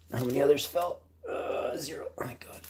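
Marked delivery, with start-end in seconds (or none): 1.3-2.6 breathy relieved whisper. Real, not acted. Relieved count of fallen bags.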